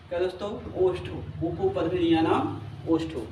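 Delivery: normal talking voice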